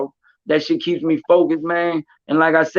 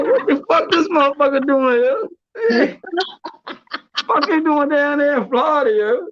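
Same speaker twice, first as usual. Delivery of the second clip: high, cracking voice full of laughter; laughing disbelief; 10 semitones higher than usual. Retold or imitated. Retelling to his friend how absurd the fight looked, laughing. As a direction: laugh through the question.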